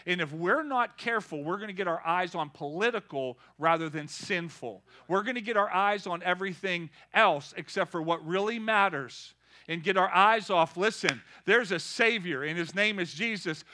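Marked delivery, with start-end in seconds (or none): none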